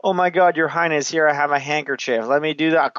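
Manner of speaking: high, eager mock servility; imitated turtle guy. Turtle guy offering the king a handkerchief, mock fawning. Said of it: "then comes the turtle guy".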